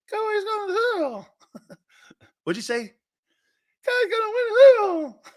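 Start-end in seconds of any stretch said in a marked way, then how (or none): 0.1-1.3 much higher, fast squeaky puppet voice; ventriloquist puppet voice. Retold or imitated. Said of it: "like a ventriloquist". 3.8-5.2 much higher, louder, fast puppet voice yelling; the dummy shouts it on command, laughing. Retold or imitated. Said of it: "Say it".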